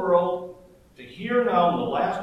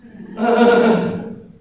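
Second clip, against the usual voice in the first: loud laugh; 4 semitones higher, 8 dB louder than usual. Real, not acted. Laughs at own fox voice.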